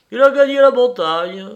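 high, loud announcement; lifted, ringing answer. A narrator's reading. Host resumes his tale, proudly.

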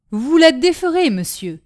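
loud, high-pitched outcry, dropping on "monsieur"; exclaimed demand. A narrator's reading. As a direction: cry out an order to undo it. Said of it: "s'écria le régisseur".

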